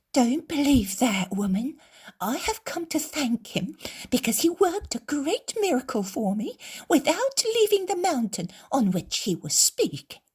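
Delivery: a hint of a kind woman soothing a friend; consoling woman. Woman consoles the weeping mother, lightly voiced.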